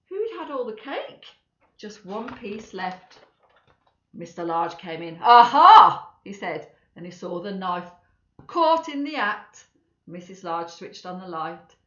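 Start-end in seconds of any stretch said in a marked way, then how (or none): 5.2-6.1 huge loud triumphant aha. Acted. Mister Large catching his wife, shouting. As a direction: catch her in the act.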